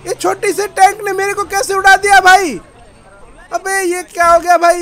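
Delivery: loud, high-pitched disbelief; shocked outcry. Real, not acted. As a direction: protest loudly at the shock. Blown up by a small tank.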